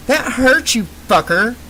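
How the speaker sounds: high, indignant whine; whiny hurt complaint. Acted. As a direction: complain like a drunk girl just pinched. Pinched character complains.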